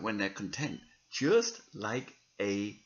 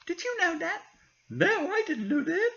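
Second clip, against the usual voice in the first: high squeaky puppet voice, much higher; a chirpy little puppet asking questions; 5 dB louder than usual. Acted. Puppet chats with reader.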